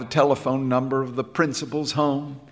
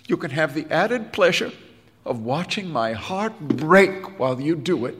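emotional; 8 semitones higher, 4 dB louder than usual. A narrator's reading. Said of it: "puffing with emotion".

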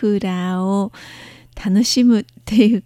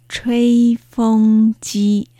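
吹風機 very slow, long held syllables; model pronunciation; much slower than usual. Shown as a demonstration. Teacher repeating the word after explaining its tones, slow model pronunciation. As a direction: stretch out each syllable of 吹風機. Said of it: "風の発音は".

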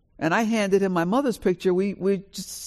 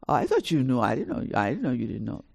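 sheepish brother; 5 semitones lower, faster than usual. Retold or imitated. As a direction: shrug it off like a clueless brother. Brother's sheepish reply voiced.

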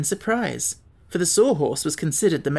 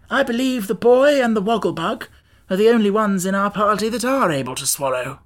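higher, emphatic character voice; lightly voiced Scarecrow, critical; 6 semitones higher, 4 dB louder than usual. Scarecrow tells who is able to swallow, lightly voiced, emphatic. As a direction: size up the party in a raised voice.

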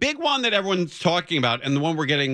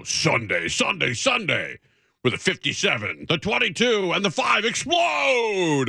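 shouted announcer build to explode; monster truck ad; 6 semitones higher than usual. Retold or imitated. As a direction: hype it like a rally promo. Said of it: "They would say".

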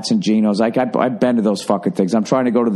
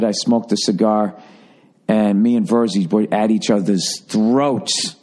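ordinary talk, nothing marked

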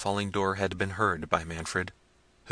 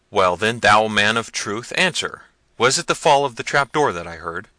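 loud, slowed furious demand; impatient cry; 9 dB louder than usual. A narrator's reading. Prince losing patience with the youth, shouting. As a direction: shout it at him.

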